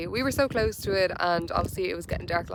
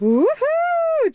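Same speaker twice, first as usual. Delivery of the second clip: cheering; 7 dB louder than usual. Real, not acted. Celebrating completed challenge.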